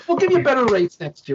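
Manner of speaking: high-pitched pretend desperate bargaining; mock begging. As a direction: plead in jest, pitch climbing as the offer grows. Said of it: "I'm just imagining E three begged them".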